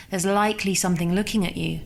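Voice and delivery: plain reading voice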